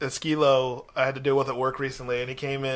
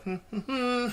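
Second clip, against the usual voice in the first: soft, high little hum; mock unfazed; 8 semitones higher, 8 dB quieter than usual. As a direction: hum it softly, not even fazed. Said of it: "not even fazed".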